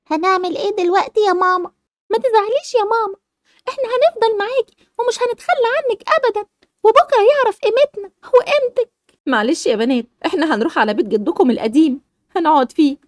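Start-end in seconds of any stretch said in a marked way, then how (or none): 0.1-8.9 a daughter consoling her mom; childlike tearful comfort; young daughter. Acted.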